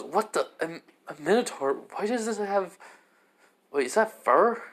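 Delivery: shocked surprise. Acted. Belle sees the strange Minotaur, rising shock. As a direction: build from stammer to disbelieving cry.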